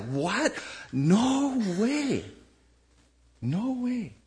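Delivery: high, incredulous no way; disbelieving crowd reaction; mock disbelief. Pastor voicing stunned listeners hearing Jesus. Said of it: "They're like".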